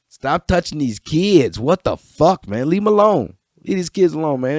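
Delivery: loud, heated yelling at abusers; angry outburst. Real, not acted. Ranting at people who hurt kids, loud and angry. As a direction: shout it, furious and disgusted.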